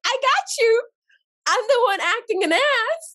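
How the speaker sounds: reassuring cousin. Retold or imitated. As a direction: back her up but warn her.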